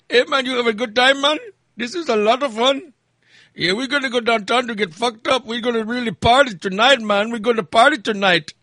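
high, excited imitated Indian accent; weird Indian accent. Indian passengers telling driver their party plans, imitated Indian accent. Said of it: "It was the weirdest accent ever".

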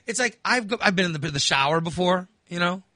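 ordinary talk, nothing marked

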